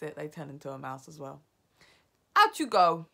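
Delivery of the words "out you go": loud, raised order; commanding dismissal. Acted. Grand High Witch sending the witches away, commanding. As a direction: send them out with a loud order. Witch leader sends them out.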